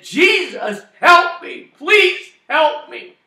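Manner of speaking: high, loud desperate plea, slowing on the last words; crying out to Jesus. Retold or imitated. Electrocuted man cries to Jesus, loud desperate plea. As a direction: beg Jesus for help at the top of your voice. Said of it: "crying out to the Lord".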